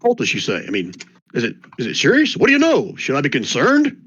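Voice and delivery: curious townsperson. Acted. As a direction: play the villager fishing for gossip. Same NPC presses for news.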